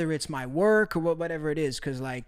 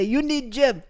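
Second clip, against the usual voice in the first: broken English catchphrase; 6 semitones higher, 4 dB louder than usual. Retold or imitated. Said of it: "at least one person to say".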